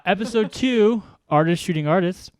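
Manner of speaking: host kicking off the show; show announcement. Real, not acted. Host announces the show's title.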